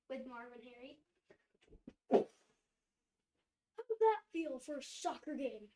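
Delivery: ordinary talk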